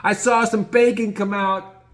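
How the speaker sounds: high, gleeful play-by-play; Gino narrating. Retold or imitated. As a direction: narrate the puking like an announcer. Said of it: "He's narrating the whole thing".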